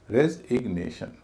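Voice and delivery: रेजिग्नेशन slowly, divided into syllables; slow demonstration of रेजिग्नेशन, syllables set apart. Shown as a demonstration. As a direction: sound out रेजिग्नेशन in separate beats. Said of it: "उसका उच्चारण फिर भारी हो रहा है".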